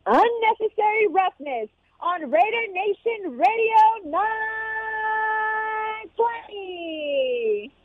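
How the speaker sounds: long drawn-out booming ring intro; ring announcer voice. Acted. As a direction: stretch every word like a fight announcer. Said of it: "bringing us in with her ring announcer voice".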